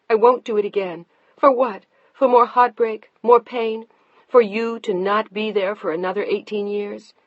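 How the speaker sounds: angry wife. Acted. Deanna fighting her husband over the baby, angry and loud. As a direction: hurl the questions back at him.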